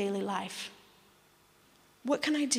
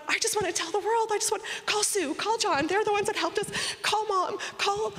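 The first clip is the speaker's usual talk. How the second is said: overjoyed; 10 semitones higher than usual. Retold or imitated. Leaving the tribunal, bursting to tell everyone. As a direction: gush it out, bursting with joy. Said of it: "and I was going".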